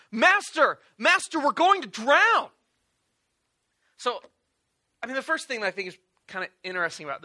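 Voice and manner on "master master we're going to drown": loud, desperate cry for help, higher; shouting. Retold or imitated. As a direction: shout to wake the master as the boat sinks.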